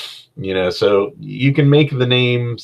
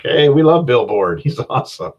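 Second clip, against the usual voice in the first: higher, gushing fan cheer with a laugh; mock enthusiasm; 5 semitones higher, 4 dB louder than usual. Players latching onto a silly NPC, mock enthusiasm. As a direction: gush like delighted players.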